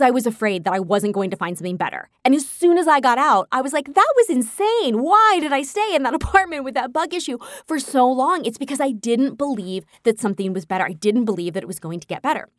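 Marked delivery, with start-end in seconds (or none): 3.9-8.4 much higher, amazed at herself; a high, laughing cry of disbelief; incredulous exclaim. Retold or imitated. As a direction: replay the disbelief at her past self. Retelling her own relief.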